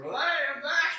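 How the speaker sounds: high, loud mock-cheerful greeting; loud high jokey "I'm back"; cheery hello. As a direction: burst in beaming. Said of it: "Sees them".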